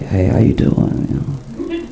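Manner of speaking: low, smooth pickup voice; laying on his thickest game; a hint of a would-be smooth talker; smooth pickup line. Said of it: "he lays his thickest game".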